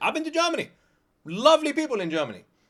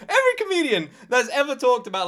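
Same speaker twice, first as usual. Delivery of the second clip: usual speaking voice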